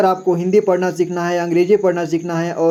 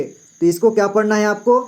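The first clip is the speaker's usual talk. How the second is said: ordinary talk, nothing marked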